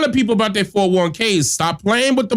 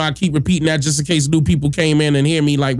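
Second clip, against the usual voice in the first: ordinary talk, nothing marked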